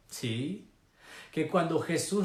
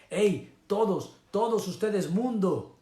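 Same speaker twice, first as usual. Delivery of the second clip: God's summons; 6 semitones higher, 4 dB louder than usual. Acted. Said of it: "y nos está diciendo".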